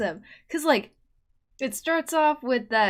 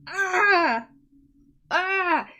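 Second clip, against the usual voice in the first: flustered cries; 7 semitones higher, 9 dB louder, much slower than usual. Real, not acted. Overwhelmed by a voice clip.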